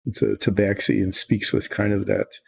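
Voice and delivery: ordinary delivery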